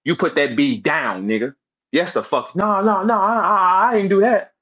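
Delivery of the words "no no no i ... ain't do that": higher, stammered mock protest; mock scared denial. Mocking rival's denial of his gang side, mock scared. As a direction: imitate him backing out in a fluster. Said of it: "what came out your scary ass mouth".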